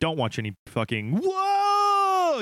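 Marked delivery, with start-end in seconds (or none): 1.2-2.4 high drawn-out fake whoa; mock surprise. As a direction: overreact like a YouTuber. Mocking YouTuber reaction.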